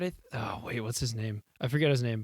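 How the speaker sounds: usual speaking voice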